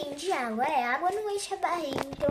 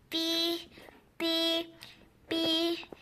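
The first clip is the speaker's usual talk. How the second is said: call button beep; 3 semitones higher, much slower than usual. Acted. Imitated flight attendant call button.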